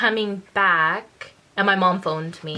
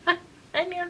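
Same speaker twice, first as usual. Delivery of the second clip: friend refusing; 11 semitones higher than usual. Retold or imitated. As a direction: back away, giggling. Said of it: "She's like".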